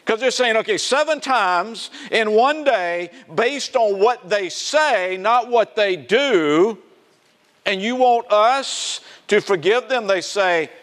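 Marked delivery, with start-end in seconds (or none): 0.5-10.1 high, slow, drawn-out sarcasm; sarcastic. Acted. Apostles balking at forgiving seven times in one day, sarcastic. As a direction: spell out the absurd demand slowly, pitched up. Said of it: "they're being very sarcastic".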